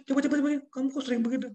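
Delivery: high, fast, loud testing command; impatient boss. Retold or imitated. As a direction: rattle off orders to the worker. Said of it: "Saya kasih bacaan".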